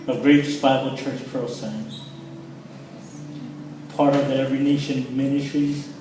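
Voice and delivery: ordinary talk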